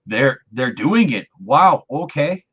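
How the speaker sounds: high, loud, thrilled disbelief; slow, awed wow at the end; excited gasp. Retold or imitated. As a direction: gush like a fan seeing it happen. Thrilled reaction to Hayden's return.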